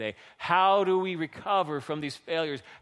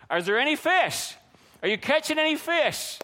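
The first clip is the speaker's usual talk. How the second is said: high, raised call across water; 9 semitones higher than usual. Acted. Jesus calling from shore to disciples fishing, calling out. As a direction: holler a question to someone far off. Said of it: "that's where the disciples yelled back".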